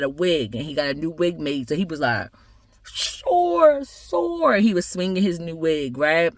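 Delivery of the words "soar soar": high, drawn-out eagle call; soaring eagle. Acted. Eagle showing off its new wig, high and showy. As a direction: sing out each word high as a majestic eagle.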